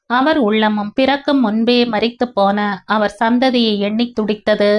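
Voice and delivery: ordinary delivery, nothing marked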